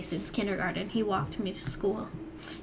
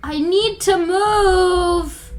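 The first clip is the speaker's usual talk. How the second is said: exasperated; 8 semitones higher, 11 dB louder, much slower than usual. Real, not acted. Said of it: "exasperated over noisy kids".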